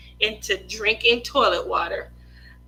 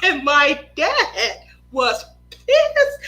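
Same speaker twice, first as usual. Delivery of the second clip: usual speaking voice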